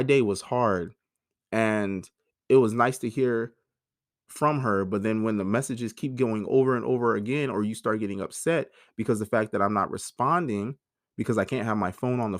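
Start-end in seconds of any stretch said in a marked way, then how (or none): none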